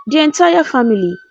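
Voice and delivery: ordinary delivery, nothing marked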